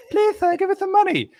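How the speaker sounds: put-on very high pleading little kid; small child voice. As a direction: beg like a tiny child at the door. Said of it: "five-year-olds knocking on your door going".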